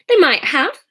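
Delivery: high, loud retort; too shrill. A narrator's reading. Sarah snaps back about the phone call, shrill. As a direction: pitch it up sharply, too shrill. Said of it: "Her voice was too shrill".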